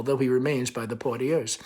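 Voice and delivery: usual speaking voice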